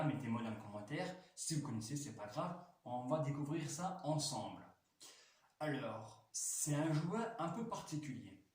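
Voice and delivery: ordinary talk, nothing marked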